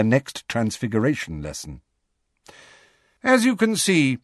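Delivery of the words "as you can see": high, crisp strict professor; stern teacher. Acted. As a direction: address the class with authority. McGonagall addresses the class.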